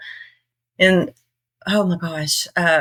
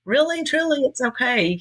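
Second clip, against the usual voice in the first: much higher, sing-song reassurance; reassuring; 9 semitones higher than usual. Retold or imitated. Telling northerners who can't understand her, high reassuring. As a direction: soothe them like a confused child. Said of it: "And so I'm like".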